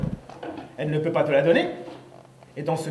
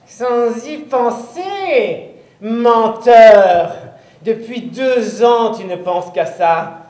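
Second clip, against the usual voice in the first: angry witch; 3 semitones higher, 7 dB louder, much slower than usual. Acted. Witch calls the boy a liar, loud and spiteful. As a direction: accuse him like a spiteful witch.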